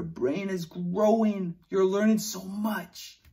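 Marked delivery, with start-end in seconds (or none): none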